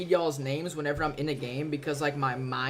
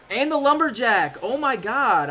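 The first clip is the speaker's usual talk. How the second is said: excited alarm; 6 semitones higher, 5 dB louder than usual. Real, not acted. Lumberjack wrecks his tower.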